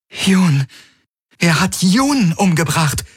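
high, appalled gasp of words; horrified realization. A narrator's reading. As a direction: let the dreadful truth hit you, pitch high. Kirai learns of killing, shocked.

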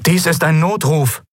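high, urgent voice over a crackling line; distress-call voice. A narrator's reading. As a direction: send out a strained emergency call. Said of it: "rauschte unerwartet eine fremde Stimme". (angespannter, hoher Notruf)

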